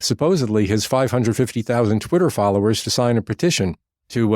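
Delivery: ordinary talk, nothing marked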